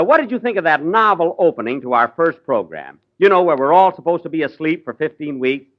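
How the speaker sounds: ordinary talk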